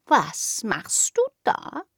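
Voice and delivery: slow, curious little cat; kitten voice. Acted. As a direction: ask it like a nosy kitten. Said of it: "fragte das Kätzchen neugierig".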